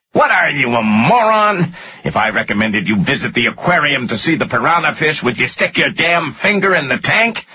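high, scornful exasperated tirade; angry yelling. A narrator's reading. Finance guy calling his boss a moron, angry yelling. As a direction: berate him in rising, high-pitched scorn. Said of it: "the guy yelled back".

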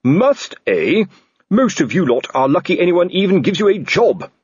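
angry shout. Acted. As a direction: blow up at the cheeky constables. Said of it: "and shouted".

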